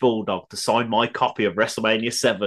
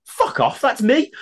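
raised, louder protest; mock indignation; 12 semitones higher, 4 dB louder, slower than usual. Jericho refusing a friend's signing request, indignant. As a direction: imitate him bristling, voice climbing at the end. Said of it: "he looks and went".

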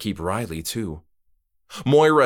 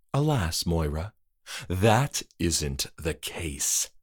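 softer, slow, low; regretful host; 4 semitones lower, 5 dB quieter, slower than usual. Acted. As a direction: gently refuse her. Trick-or-treater denies.

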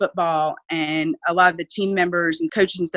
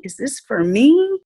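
put-on amazed child; 6 semitones higher, 4 dB louder, slower than usual. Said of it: "he said".

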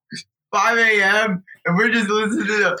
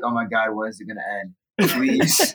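exhausted plea; 7 semitones lower, 3 dB quieter than usual. Retold or imitated. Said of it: "you're just like".

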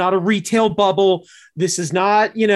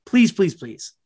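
pleading; 3 semitones lower, slower than usual. Real, not acted. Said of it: "Please do it".

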